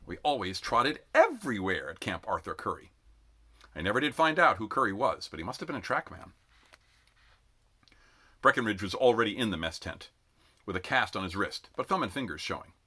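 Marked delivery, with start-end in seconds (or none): none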